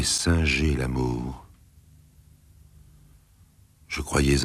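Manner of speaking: slow, hollow, sinking to a whisper; toneless voice. A narrator's reading. Marcel accusing Gabrielle, toneless and slow. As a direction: speak emptied of feeling, slowly. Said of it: "d'une voix blanche".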